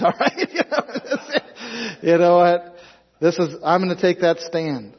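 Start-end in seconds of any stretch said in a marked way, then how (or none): 0.0-2.8 laughing. Real, not acted. Laughing defiance.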